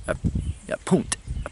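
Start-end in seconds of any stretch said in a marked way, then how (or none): 0.8-1.1 "punt" with a short oo vowel, pitched high; local pronunciation. Shown as a demonstration. Demonstrating local word for pound, high "punt" with oo. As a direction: pop out "punt" high, oo in place of ow. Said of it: "That's how they pronounce".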